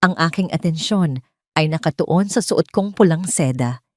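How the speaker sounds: ordinary delivery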